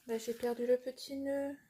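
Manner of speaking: ordinary talk, nothing marked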